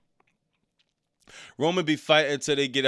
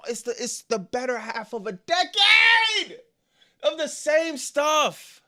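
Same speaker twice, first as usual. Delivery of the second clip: frustrated outburst; 10 semitones higher, 3 dB louder than usual. Real, not acted. Ranting about wasted Roman years.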